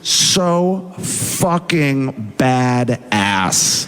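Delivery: very slow, every word of "so fucking badass" drawn out; emphatic verdict. Real, not acted. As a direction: stretch and punch each word in "so fucking badass".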